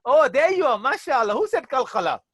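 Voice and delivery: reward the student with a bright cry of approval; delighted praise. Real, not acted. Teacher praising the student who answered qalqala, delighted.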